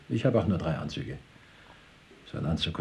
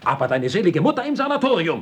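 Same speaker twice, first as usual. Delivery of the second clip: high-pitched, loud outburst; worked-up, furious comeback; indignant shout; 10 semitones higher, 6 dB louder than usual. Acted. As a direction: shout the retort over him. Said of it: "Was schreist du nur so?".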